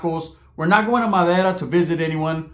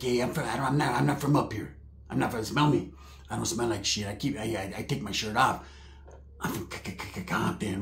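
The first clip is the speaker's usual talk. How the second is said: low, very soft cousin voice; hushed imitated stutter; stammering boastful cousin; 8 semitones lower, 11 dB quieter than usual. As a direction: fumble the brag quietly as the cousin. Plays cousin stammering his boast.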